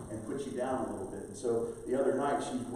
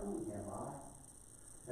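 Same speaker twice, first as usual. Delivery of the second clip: slow hushed question; 12 dB quieter, much slower than usual. Acted. Question voiced.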